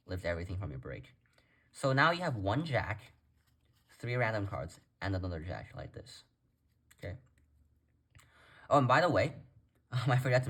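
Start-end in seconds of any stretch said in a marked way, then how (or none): none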